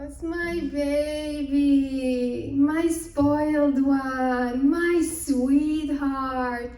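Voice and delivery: put-on slow, drawn-out, near-crying over his baby; doting tearful lament. Father pleading for his youngest daughter, slow tearful lament. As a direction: stretch each word like a father mourning his spoiled girl. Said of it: "He's like, "Oh".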